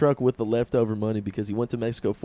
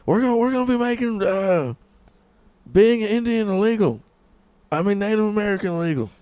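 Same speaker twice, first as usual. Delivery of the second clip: high mocking speech; 7 semitones higher, 4 dB louder than usual.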